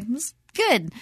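"good" lifted high and quiet; small, high, noncommittal "good"; voice going up. Retold or imitated. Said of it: "when your voice goes up".

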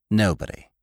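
a touch of contempt; contemptuous. A narrator's reading. Girls answering Laura, with mild contempt. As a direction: dismiss him in one scornful word. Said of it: "they answered with contempt".

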